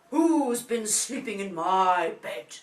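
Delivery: big, loud, slow bear father; deep Daddy voice. A narrator's reading. Daddy bear finds his bed messed up, deep booming voice. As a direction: boom each word out slowly. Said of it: "in his deep voice again".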